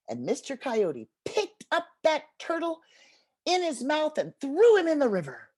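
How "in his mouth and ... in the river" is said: usual speaking voice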